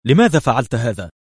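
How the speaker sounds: mildly angry, pushed question; sharp demand. A narrator's reading. Bruno confronts Livi at his desk, sharply. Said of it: "قائلا في حدة".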